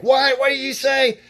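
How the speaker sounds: high, loud shouted question; yelling. Acted. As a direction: yell it out like you can't hear. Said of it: "so you're yelling at them. I don't mean to yell".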